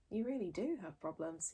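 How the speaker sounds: soft, low awed voice. Acted. Fishlegs watching Hiccup fail, soft and awed. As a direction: say it in hushed amazement. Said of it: "in an awed voice".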